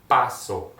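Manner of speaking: "Paso" slowly, syllables set apart, first one stressed. Shown as a demonstration. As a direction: say "Paso" deliberately for learners to copy. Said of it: "Let's go back, slower".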